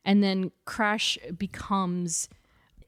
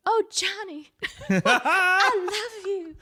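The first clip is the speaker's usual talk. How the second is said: high, breathy swooning damsel; damsel in distress voice; 11 semitones higher, 3 dB louder, much slower than usual. Retold or imitated. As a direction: gush it like a fifties heroine in distress. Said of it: "that damsel in distress voice".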